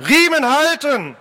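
high-pitched order to the crew; shouted command. Acted. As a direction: call the order across the boat. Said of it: "rief Phileason".

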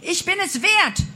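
high, loud defiant cry; shout. A narrator's reading. Heroine shouting her worth at the flames, loud cry. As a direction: shout it out with full conviction. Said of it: "schrie sie den Flammen entgegen".

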